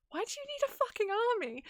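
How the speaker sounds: incredulous outburst. Retold or imitated. Miria silently questions Horatio's demand in high, fast disbelief. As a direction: fire it off in pitched disbelief.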